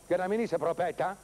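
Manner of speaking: ordinary delivery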